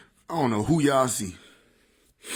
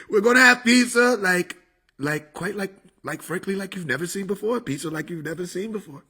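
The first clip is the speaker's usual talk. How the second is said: Trump; 6 semitones higher than usual. Acted. Comedian as the president promising unprecedented pizza, Trump impression. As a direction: start big, then trail into confiding asides. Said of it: "Trump impression was spot on".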